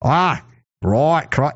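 fumbling attempt at Australian vowels; broken-off Australian accent attempt; failed Australian accent. Shown as a demonstration. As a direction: test out an Australian accent, unsuccessfully. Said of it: "I can't do it".

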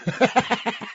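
silent wheezing laugh at his own joke; breathless laugh. Real, not acted. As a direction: laugh without voice, caught off guard. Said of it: "don't laugh at your own jokes".

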